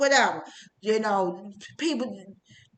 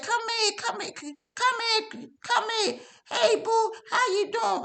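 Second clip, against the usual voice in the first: high, sugary mock greeting, much higher; phony sweetness; 9 semitones higher, 3 dB quieter than usual. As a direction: imitate a fake-friendly church lady cooing. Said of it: "This is so phony".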